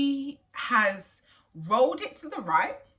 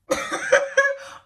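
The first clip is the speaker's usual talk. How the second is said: burst of laughter; 4 dB louder than usual. Real, not acted. Laughs at mum not knowing.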